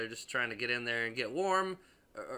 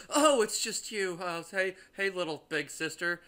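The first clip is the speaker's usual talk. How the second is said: mocking the brother's relieved greeting; 6 semitones higher, 4 dB louder than usual. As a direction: lightly ham up the relief. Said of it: "it's just like".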